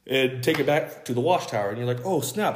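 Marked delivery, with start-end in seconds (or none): 2.0-2.5 surprised. Retold or imitated. Viewer surprised at Watchtower.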